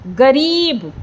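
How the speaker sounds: "गरीब" with "री" held long; short "ग", then draw out the ee of "री"; teacher's "गरीब" with a long second syllable; stretched ee. Shown as a demonstration. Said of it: "रा को खींच के मैंने बोला".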